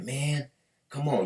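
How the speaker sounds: playful imitation of annoyed buyers; pleading buyers. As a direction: coax the stubborn seller, drawn out.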